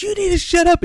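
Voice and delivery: very high-pitched mock retort; imitated angry lady. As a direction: fire back as the furious woman. Lady snaps back, acted.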